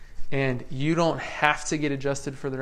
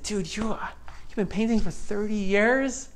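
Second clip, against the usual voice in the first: higher, surprised question to the painter; retold surprise; 6 semitones higher than usual. Telling the painter his years amaze him, surprised. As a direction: marvel at the painter's years on the job. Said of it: "I said".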